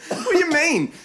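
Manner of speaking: usual speaking voice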